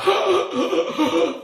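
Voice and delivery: sharp high gasp. Retold or imitated. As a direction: gasp in panic. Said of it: "heavy anxiety attack".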